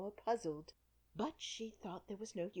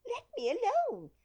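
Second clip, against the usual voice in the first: high, loud bird squawk; shrieking pigeon; 10 dB louder than usual. A narrator's reading. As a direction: pigeon shoos Alice away, loud and high. Pigeon attacking Alice.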